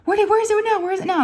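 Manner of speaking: high-pitched mock panicked questions; scared. Daughter asking about a mouse, scared. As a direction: imitate a frightened daughter, pitched well up. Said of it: "she's all scared".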